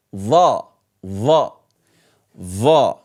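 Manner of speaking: va, va, va low and heavy. Shown as a demonstration. Teacher drilling the heavy va. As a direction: say va deep. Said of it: "So the va is this".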